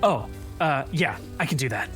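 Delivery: low, soft, automatic answer; a quick, low, reflexive yes; instinctive reply. Acted. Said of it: "an almost instinctive thought goes".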